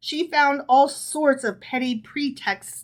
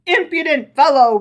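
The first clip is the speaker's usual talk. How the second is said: louder, higher furious roar; 5 semitones higher, 8 dB louder than usual. A narrator's reading. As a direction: bellow at the prankster. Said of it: "roared the rabbi".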